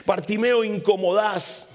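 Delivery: reproche fuerte y más agudo; gente regañando. Acted. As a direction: callarlo con fastidio. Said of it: "se acerca y le dice". (la multitud le regaña con fastidio)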